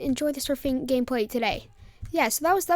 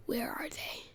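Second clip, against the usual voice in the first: very soft, breath-only question; hushed imitation of a suspicious mom; ominous whisper; 16 dB quieter than usual. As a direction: whisper it as a dawning realization. Mom realizes friends are missing.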